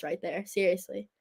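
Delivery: breathy whispered aside. Real, not acted. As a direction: drop to a whisper.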